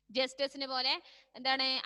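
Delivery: ordinary talk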